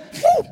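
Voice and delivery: high shrieked whoop; mock startled whoop. Preacher imitating the startled woman's shrieked whoop. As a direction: yelp like she has seen a preacher. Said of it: "she just went".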